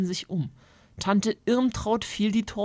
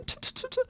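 quick disapproving tsk tsk; tutting tongue clicks; 8 dB quieter, much faster than usual. Acted. Aunt tuts over the feathers everywhere.

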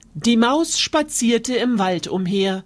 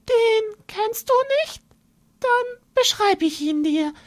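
mouse spinning a scary tale, much higher; boastful little mouse; 10 semitones higher than usual. Acted. Mouse scaring the fox with the monster, high and sly.

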